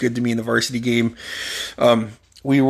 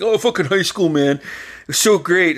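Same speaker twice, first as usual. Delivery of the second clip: mock nostalgia; 5 semitones higher than usual. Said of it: "sit back and be like".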